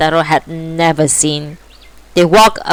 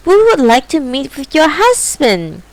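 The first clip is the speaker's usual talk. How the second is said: cat voice; 8 semitones higher than usual. Acted. Cat's voice.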